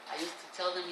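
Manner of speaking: usual speaking voice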